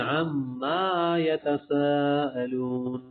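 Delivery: slow chant with a doubled m in عما; wrong recitation. Shown as a demonstration. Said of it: "اس طرح آپ نے کر دیا".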